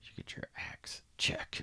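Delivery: breathless whisper, no voice; whispered checklist. Pretend. Co-host ticking off a killer's gear list, whispered. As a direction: hush it like a secret ritual.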